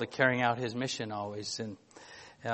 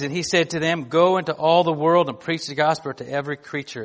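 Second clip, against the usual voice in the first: louder, measured reading of the Bible passage; scripture reading; 3 semitones higher, 8 dB louder than usual. Acted. Pastor reading scripture to his church, louder and solemn. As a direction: read the Gospel out to the congregation with weight. Preacher reads Mark passage aloud.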